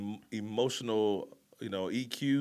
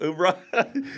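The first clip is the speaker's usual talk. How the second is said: laughing battle cry; 7 semitones higher, 7 dB louder than usual. Acted. Marine cry repeated laughing.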